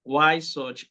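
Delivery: ordinary talk, nothing marked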